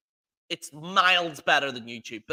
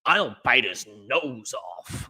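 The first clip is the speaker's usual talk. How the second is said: 1 mock Jericho; 9 semitones lower, slower than usual. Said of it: "Chris says in this interview".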